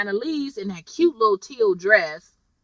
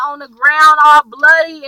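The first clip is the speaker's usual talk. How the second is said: usual speaking voice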